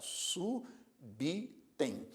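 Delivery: "Subitem" drawn out very slowly as su-bi-tem; split into syllables. Shown as a demonstration. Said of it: "o prefixo fica separado". ("Subitem" bem devagar, sílaba por sílaba)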